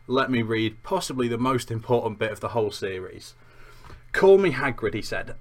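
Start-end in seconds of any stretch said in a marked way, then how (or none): 4.1-4.9 put-on lightly accented hearty giant, louder and higher; Hagrid. Giant introducing himself to Harry, hearty accented voice. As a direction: introduce yourself loudly in a light rustic accent. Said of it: "with an accent when I'm doing Hagrid".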